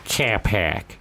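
low, exaggerated Boston vowels; mock Boston accent. Cohost mocking his friend's one-phrase accent, mock Boston. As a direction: laughingly mimic a Boston accent. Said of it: "play someone from Boston".

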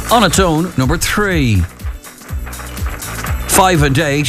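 calling the numbers with pauses; bingo caller. Acted. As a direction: sing out each bingo number, then repeat it.

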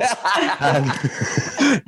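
high rising laugh. Real, not acted. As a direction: burst out laughing. Laughs at tripod joke.

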